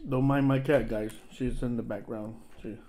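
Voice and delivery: eating between words; talking while chewing. Real, not acted. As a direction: mouth partly full of food. Seen 2: Bitten sandwich held at mouth; lips closed, seems to be chewing.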